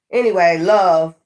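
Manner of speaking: usual speaking voice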